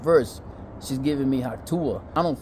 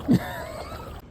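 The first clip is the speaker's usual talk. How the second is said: high laugh after a punchline; amused high chuckle; 5 dB quieter than usual. Real, not acted. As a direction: laugh at your own joke.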